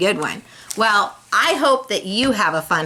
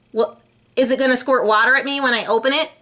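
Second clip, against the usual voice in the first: fast, rattled-off worried questions; nervous rush; 5 semitones higher, much faster than usual. Acted. Lewis firing worried questions at Gilbert, fast. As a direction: hurry through it suspiciously.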